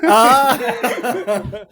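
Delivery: loud, high laughing "ah"; real burst of laughter. Real, not acted. Guest reacting to the host's joke, loud laugh.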